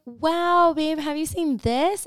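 bright, eager high voice; excited. Retold or imitated. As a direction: gush about something new. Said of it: "I'll be like".

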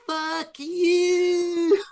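high mocking shout of fuck you; bratty kid yelling from a limo; imitated taunting yell. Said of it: "he started going".

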